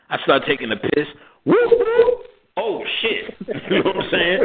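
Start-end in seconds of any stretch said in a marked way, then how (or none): 1.4-2.3 loud high whooping siren; siren imitation. Narrating cops rolling up, loud high siren whoop. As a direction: mimic a cop siren.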